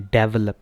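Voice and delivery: Develop as "DEV-uh-lup"; loud punch on the first syllable of develop; wrong first-syllable stress. Shown as a demonstration. Teacher showing the common error, develop stressed at the front. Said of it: "इसको develop पढ़ते हैं".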